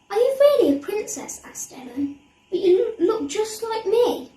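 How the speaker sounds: put-on a young girl's astonished question; wide-eyed disbelief; surprised child. A narrator's reading. Ellen asks, surprised.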